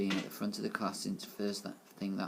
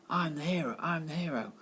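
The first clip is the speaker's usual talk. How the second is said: yelling; 6 semitones higher than usual. A narrator's reading. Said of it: "he yelled".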